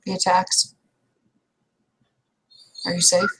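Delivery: usual speaking voice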